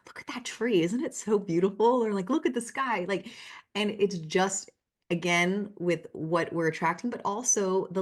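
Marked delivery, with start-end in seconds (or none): 0.1-3.1 high, bright-eyed marvel at nature; mock dreamy wonder; delighted wonder. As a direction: gush over the tree and sky. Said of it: "And I'm like".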